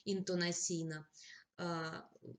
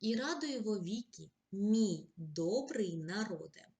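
slow, louder poetic exclamation; poem recitation; 4 dB louder, slower than usual. Acted. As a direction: declaim the address like a poem. Reads poem example.

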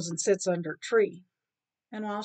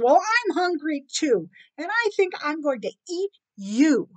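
higher, louder cat threat; menacing cat; 6 semitones higher, 6 dB louder than usual. Acted. Hungry cat threatens guilty rat, menacing. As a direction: lean on the words with indignant menace.

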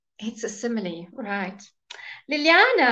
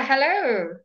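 ordinary talk, nothing marked

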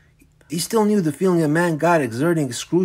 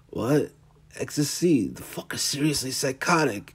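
quiet, shaken disbelief; horrified hushed voice; 7 dB quieter than usual. Acted. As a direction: mutter it in disgust. Michael's horrified inner reaction.

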